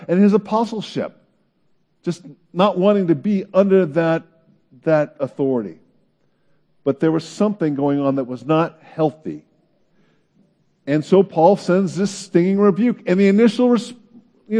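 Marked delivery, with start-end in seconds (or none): none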